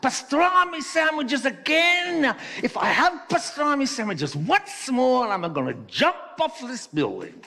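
put-on loud, high, exasperated Italian accent; big operatic Italian outburst; hammed-up Italian worker. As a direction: wail it like an over-the-top Italian, fed up. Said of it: "the Italian opened his lunchbox".

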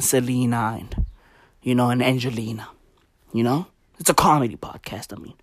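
fast whispered aside. Real, not acted. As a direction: mutter it under breath.

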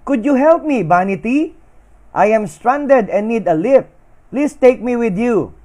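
Love begging Vanity for a lift, shouting high; shouted plea. A narrator's reading. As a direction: cry out to the passing boat. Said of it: "Again, Love shouted".

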